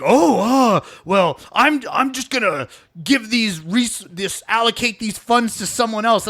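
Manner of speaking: mock the caller backing off; lightly voiced telemarketer fumbling for an excuse; imitating telemarketer. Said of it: "he's like".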